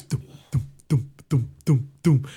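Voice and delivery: steady, evenly spaced thumps; imitated thumping. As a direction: mimic something thudding closer. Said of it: "all I hear is".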